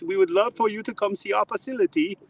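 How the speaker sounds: put-on high, courteous German-accented invitation; warmly invite, German CEO. Owner invites Chad to the facility, German accent.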